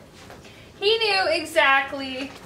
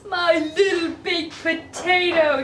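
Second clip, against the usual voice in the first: doting coo in a higher voice; 4 semitones higher, 4 dB louder than usual. A narrator's reading. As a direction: coo it to a beloved pet. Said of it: "she cooed".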